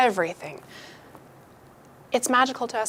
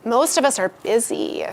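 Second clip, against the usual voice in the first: normal talking voice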